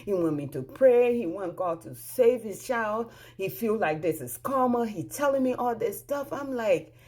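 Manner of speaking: ordinary talk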